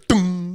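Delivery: high, ringing "dum"; imitated impact thud. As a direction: mimic a body hitting a railing. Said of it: "you just hear".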